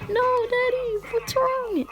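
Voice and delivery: put-on high child voice, a touch worried; little girl voice. Daughter asking her fallen dad, high little-girl voice. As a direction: squeak it out like a small girl. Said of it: "And Ari's like".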